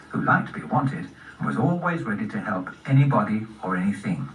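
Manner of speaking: usual speaking voice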